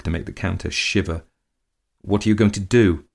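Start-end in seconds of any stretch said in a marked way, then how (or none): none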